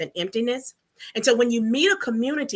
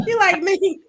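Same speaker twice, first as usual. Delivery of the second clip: higher, louder through real laughter; laughing; 10 semitones higher, 8 dB louder than usual. Real, not acted. Co-host cracking up at her friend's confession, laughing. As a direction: burst out laughing and keep talking.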